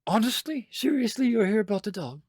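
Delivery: high-pitched disbelieving questions; incredulous wife. Acted. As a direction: ask it as if you can't believe it.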